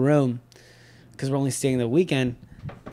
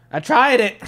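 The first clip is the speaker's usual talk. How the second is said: high, loud, giddy blurt; eager confession; 10 semitones higher, 8 dB louder than usual. Acted. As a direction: blurt out your secret to dad. Acted excited confession to dad.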